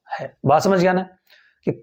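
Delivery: ordinary delivery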